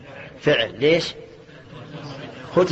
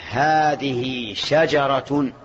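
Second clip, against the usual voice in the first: slow example; much slower than usual. Shown as a demonstration. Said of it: "تقول".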